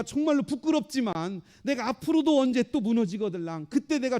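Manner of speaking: high, urgent plea to God; fervent prayer. Acted. Preacher begging God not to delay in saving him, fervent prayer. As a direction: pray with rising pitch and pressing need. Prays aloud in the first person.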